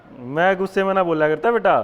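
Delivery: loud, playful mock scolding; teasing loud "I don't get angry"; mock angry denial. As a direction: protest loudly that you're never angry, half joking. Denies anger while speaking loudly.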